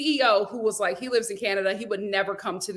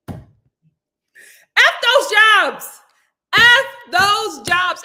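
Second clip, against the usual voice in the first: very slow, high and loud; drawn-out loud defiance; 10 semitones higher, 9 dB louder, much slower than usual. Real, not acted. Telling her viewers to quit toxic jobs, drawn-out loud defiance. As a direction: stretch every word out in defiance.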